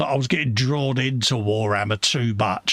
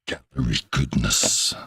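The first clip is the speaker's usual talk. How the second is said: deep announcer voice; 12 semitones lower than usual. Pretend. Mock announcer voice for show.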